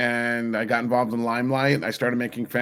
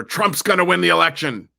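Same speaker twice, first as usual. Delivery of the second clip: raised, louder mock proclamation; retold exclamation, pitched up; quoted last words; 6 semitones higher, 6 dB louder than usual. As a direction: announce it high and loud, as a quote. Quoting her dying words.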